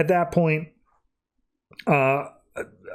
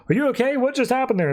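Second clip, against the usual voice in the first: high-pitched acted worry; urgent questions, pitched well up; alarmed concern; 10 semitones higher, faster than usual. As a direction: retell a worried check on a friend. Friends check on blasted teammate.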